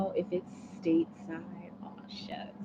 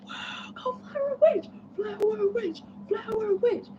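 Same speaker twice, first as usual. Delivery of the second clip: much higher, slow squealing cheer. Pretend. Cheering on the nervous writer, "go Flower Witch" in a high squeal. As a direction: cheer her on like a giddy fan. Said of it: "just think of me like".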